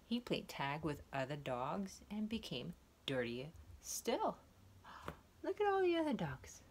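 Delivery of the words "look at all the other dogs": excited. Acted. Excited reading to child.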